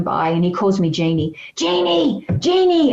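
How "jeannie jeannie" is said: high, louder call across the street; retold call of her name.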